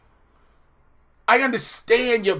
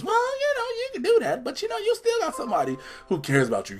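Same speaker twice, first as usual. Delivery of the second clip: put-on much higher falsetto for the girlfriend; mock Abby voice; 11 semitones higher, 9 dB quieter than usual. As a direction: squeak it out like a caring girlfriend.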